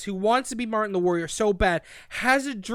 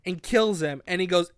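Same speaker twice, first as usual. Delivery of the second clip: normal talking voice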